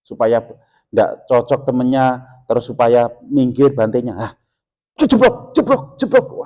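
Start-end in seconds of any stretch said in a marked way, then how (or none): 4.1-6.4 high, loud careless banging noises; careless slamming. Retold or imitated. Careless helper slamming things at the vihara, high mock banging.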